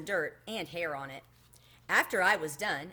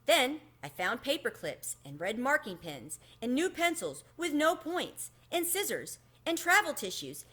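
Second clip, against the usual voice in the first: usual speaking voice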